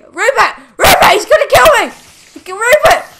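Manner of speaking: high, loud panicked calls to the dog; frantic yelling. Real, not acted. Player yelling at pet dog Rupert in a fight, panicked shouting. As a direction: scream at the dog to save you.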